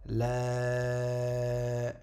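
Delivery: LA held very long, level pitch; prolonged vowel. Shown as a demonstration. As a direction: sustain the vowel of LA. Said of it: "avec la prolongation du alif".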